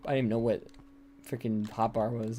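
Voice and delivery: usual speaking voice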